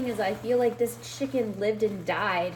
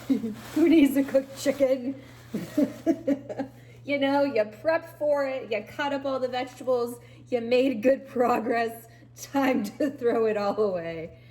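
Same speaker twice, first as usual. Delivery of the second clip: sarcastic laughing; 4 semitones higher, 4 dB louder than usual. Real, not acted. Mocking her wasted effort.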